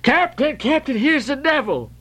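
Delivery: high alarmed bumpkin yell; frantic yell. A narrator's reading. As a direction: shriek it like you've seen the devil. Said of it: "yelled the bumpkin".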